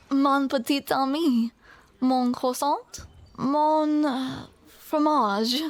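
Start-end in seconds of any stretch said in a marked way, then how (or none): none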